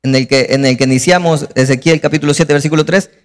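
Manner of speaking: normal talking voice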